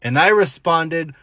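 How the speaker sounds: ordinary talk, nothing marked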